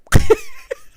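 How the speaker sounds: a sudden high squeal of laughter; high shrieking laugh. Real, not acted. Reviewer cracking up at Troy's word, shrieking laugh. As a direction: burst out in a shrill laugh.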